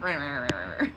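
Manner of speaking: playful "rah-rah" pet noise with a laugh; imitated animal grumble.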